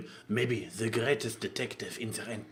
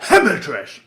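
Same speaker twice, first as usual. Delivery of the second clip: loud, gleeful blurt of the fan nickname; excited shout; 5 semitones higher, 12 dB louder than usual. Real, not acted. Co-host proudly blurting the Hamilton fandom term, excited shout.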